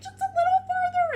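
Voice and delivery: very high, loud urging; squeaky-high encouragement; urgent cheering. Acted. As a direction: egg him on through the last stretch. Wolf urges snake on.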